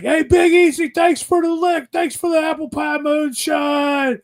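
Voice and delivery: high shouted thanks from across the camp; grateful campers shouting thanks across camp; calling out thanks. Retold or imitated. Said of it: "you hear 'em like".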